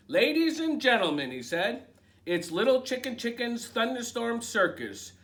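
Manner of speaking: high, loud showman's introduction; ringmaster announcement. Acted. Rooster announcing the show to the coop, high loud ringmaster call. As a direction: present the act like a circus ringmaster.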